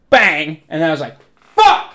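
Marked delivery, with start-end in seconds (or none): 1.5-2.0 very high, loud burst of cursing; re-enacted angry yell. Player re-enacting his outburst for friend, angry mock yell. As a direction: yell it suddenly, like the shot just missed. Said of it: "that's pretty accurate".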